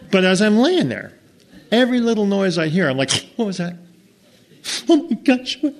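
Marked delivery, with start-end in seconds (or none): 3.4-5.8 mock fright. Hearing night noises scared.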